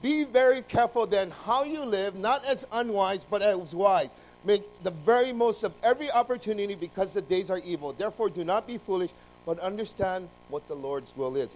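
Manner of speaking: preacher reading Ephesians aloud; scripture reading. Acted. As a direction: proclaim the verse to the congregation.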